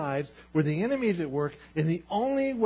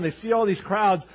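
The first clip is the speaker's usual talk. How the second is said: ordinary talk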